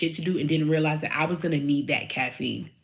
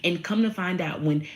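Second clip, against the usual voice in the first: ordinary talk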